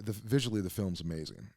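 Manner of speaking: usual speaking voice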